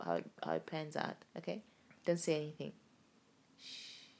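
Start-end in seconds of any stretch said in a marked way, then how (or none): none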